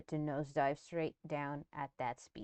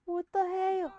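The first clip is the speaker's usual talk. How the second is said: high, loud mimicry of a Philly accent; imitated Philly accent; 14 semitones higher, 6 dB louder than usual. Viewer echoing the man in the clip, mock Philly accent. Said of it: "You hear his accent?".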